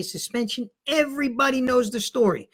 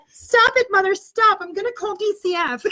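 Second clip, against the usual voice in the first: kid's mock yell; 9 semitones higher than usual. Kid yelling at mom in car.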